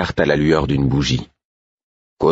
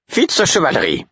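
sharp, much higher call of his name; dry sharp call; 11 semitones higher than usual. A narrator's reading. Caudron sharply calling Fitz back, curt high call. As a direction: snap his name out curtly. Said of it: "la sécheresse du ton de Caudron".

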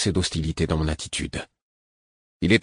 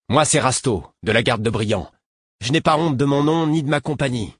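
proud defiance; 6 semitones higher than usual. Acted. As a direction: throw your name at him like a challenge. Young guard proudly names himself.

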